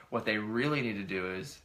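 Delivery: ordinary talk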